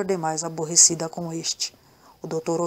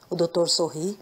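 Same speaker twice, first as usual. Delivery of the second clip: ordinary delivery, nothing marked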